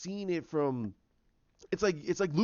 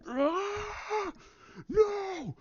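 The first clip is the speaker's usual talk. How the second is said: very high, straining scream; anguished scream; much slower than usual. Retold or imitated. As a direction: act out a drawn-out, anguished cry. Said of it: "He's like".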